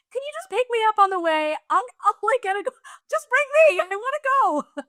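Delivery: a grown woman pretending to be a child begging to tag along; childish pleading. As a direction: beg like an eager little kid. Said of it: "I know it sounds, like, very childish".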